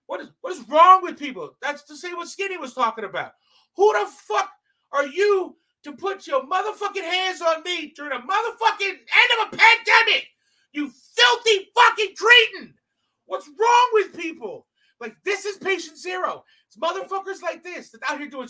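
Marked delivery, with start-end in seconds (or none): 3.8-14.7 loud, high shouting rant; furious rant. Real, not acted. Comic raging to the audience about being touched, furious shouting. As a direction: blast the question at the room. Ranting at the crowd.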